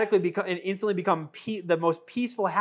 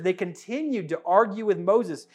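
usual speaking voice

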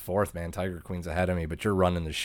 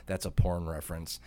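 ordinary delivery

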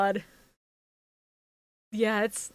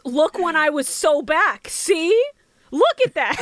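higher, louder, slow insistence; indignant show-off; 7 semitones higher, 7 dB louder than usual. Retold or imitated. Bastion showing off his old wins, loud insistent voice. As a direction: proudly push old footage in their faces. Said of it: "He literally goes".

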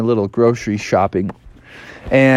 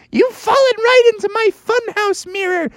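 high evil cackle and taunt; villain voice, laughing; cackling Joker. Acted. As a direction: gloat over the trap.

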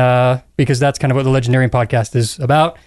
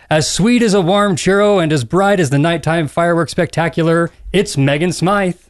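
smooth theme-park announcer introduction; Bill Rogers impression. Host introducing a panelist, announcer voice. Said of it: "Bill Rogers impression".

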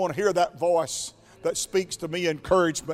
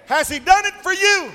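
same question thrown out much louder and higher; preaching shout; 12 semitones higher, 9 dB louder than usual. Real, not acted. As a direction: ask it again, now shouting.

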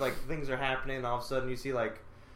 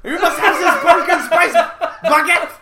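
high, loud mock German order with laughs; German accent shout; 12 semitones higher, 13 dB louder than usual. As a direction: bark the order like a cartoon German.

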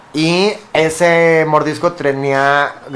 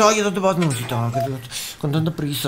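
seductive cat; 2 semitones lower, 6 dB quieter than usual. Acted. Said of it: "como era gato, le dice".

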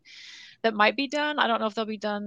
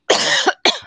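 sharp dry cough burst; coughing fit; 10 dB louder than usual. Real, not acted. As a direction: cough hard, unvoiced. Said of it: "sorry, I'm coughing so much".

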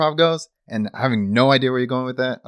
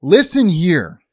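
angry shout; 6 semitones higher, 6 dB louder than usual. Acted. Astronaut yells at new controller.

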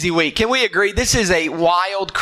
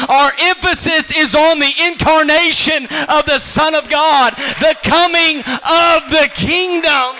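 louder, slow, ringing sermon cry; full-force preaching; 5 dB louder than usual. Acted. Pastor to the church, preaching at full force. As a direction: preach it out to the whole room.

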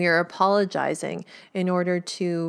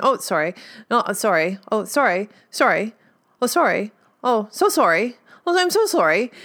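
polite sorries building higher and louder; mock apology ping-pong, rising in pitch; escalating apologies; 3 semitones higher, 4 dB louder than usual. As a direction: keep topping the last apology, climbing to a high, loud finish. Acted escalating mutual apology exchange.

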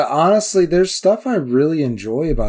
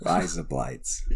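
low, slow, soft try at Aussie; tentative mock-Australian echo; Australian accent; 9 semitones lower, 9 dB quieter, slower than usual. Shown as a demonstration. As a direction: try out the Australian phrase under your breath. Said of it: "you say".

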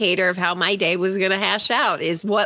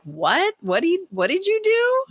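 incredulous; 9 semitones higher than usual. Retold or imitated. Future generations reacting.

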